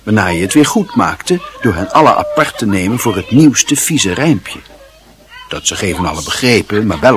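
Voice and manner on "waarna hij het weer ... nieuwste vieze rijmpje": ordinary delivery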